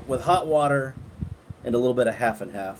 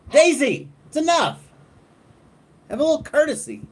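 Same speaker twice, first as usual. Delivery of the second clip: loud, high scolding call; scolding the dog; 5 dB louder than usual. Real, not acted. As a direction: call the dog off firmly, loudly.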